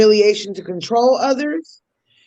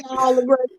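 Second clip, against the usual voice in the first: ordinary talk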